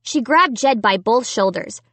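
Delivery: ordinary delivery, nothing marked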